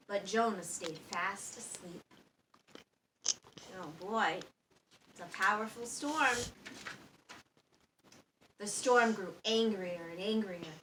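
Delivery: ordinary delivery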